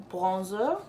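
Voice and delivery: bronzer said the French way, as plonzo; a playful French accent on Bronzer, plonzo; mock French. Vlogger joking about French pronunciation, mock French accent. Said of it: "That's how they say it when they are French".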